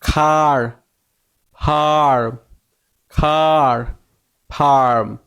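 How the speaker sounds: খার and ফার্ম drawn out with the mouth wide open. Shown as a demonstration. Teacher modelling words for learners, খার and ফার্ম with a wide-open "ar". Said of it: "হা করতে হবে, তা নাহলে কিন্তু উচ্চারণ সঠিক হবে না".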